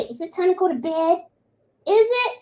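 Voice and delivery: high pet-voice questions; voicing the dog. Speaking for a dog wanting bed, high pet voice. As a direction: ask it high, as the dog would. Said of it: "he's trying to say".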